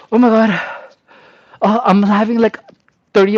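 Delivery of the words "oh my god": usual speaking voice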